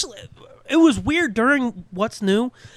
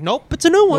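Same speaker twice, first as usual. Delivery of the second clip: higher, loud, chipper reply; mock announcer; 10 semitones higher, 6 dB louder than usual. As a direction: toss it off like a promo. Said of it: "And it's like".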